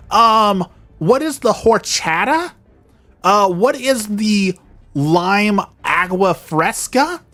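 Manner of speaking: high, slow, airy ditzy voice; mocking a clueless customer. Vlogger imitating the restaurant lady, high and ditzy. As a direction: mimic her naive questions in a high voice. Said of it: "She's like".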